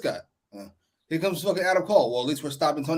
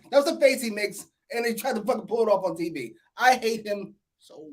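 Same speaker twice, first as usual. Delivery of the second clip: ordinary talk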